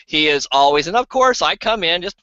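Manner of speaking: normal talking voice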